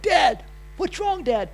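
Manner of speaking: put-on high, slow worried child voice; kid's voice. Kids at breakfast asking dad, high and worried. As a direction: play a frightened little kid calling dad. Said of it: "The kid's like".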